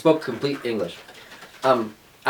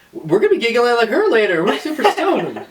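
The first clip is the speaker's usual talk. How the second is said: put-on joking to the giggling girl about being stoned; laughing joke; 10 semitones higher, 4 dB louder than usual. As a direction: laugh through the fake confession. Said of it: "I was like".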